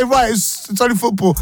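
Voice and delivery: high mocking echo of his words; co-host mockingly repeats his line, high teasing voice; mock quote. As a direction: throw his words back at him, teasing. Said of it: "Did I just hear you say".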